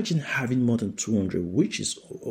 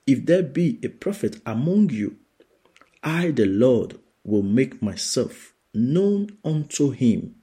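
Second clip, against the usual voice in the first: preaching scripture; 3 semitones higher than usual. Acted. Preacher reading the Lord's words to the church, proclaiming. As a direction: declare it as the Lord speaking.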